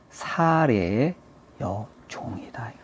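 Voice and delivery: very slow, falling to near whisper; pointed slow pronouncement. Acted. Angel reminding Hagar of her station, slow and hushed. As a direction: spell it out slowly, sinking very soft.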